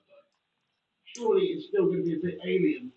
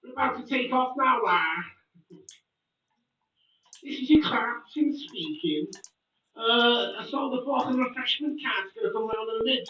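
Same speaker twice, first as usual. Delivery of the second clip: mock Scouse pilot; 7 semitones higher than usual. Joking to his viewers as a Scouse pilot, in mock Scouse. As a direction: play the Scouse pilot again, cheeky and over the top. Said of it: "Could you imagine a Scouse pilot?".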